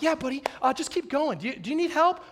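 a senior faking help to a freshman; sending the freshman off with fake friendly help. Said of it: "I'm like".

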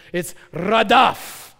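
radaf said slowly, rolled R, open ah vowels; Hebrew radaf. Shown as a demonstration. Preacher teaching youth group the Hebrew word radaf. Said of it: "it says like this".

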